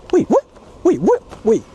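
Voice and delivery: sudden high-pitched double take; real shock at a strange animal; startled. Real, not acted. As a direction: caught off guard, pitch jumping up.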